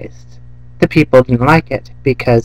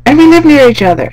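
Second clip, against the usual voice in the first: much higher, louder, fast yelling; shouted dog chat; 8 dB louder than usual. Retold or imitated. Two dogs yelling to each other at night. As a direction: shout it gleefully across yards. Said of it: "And they're like".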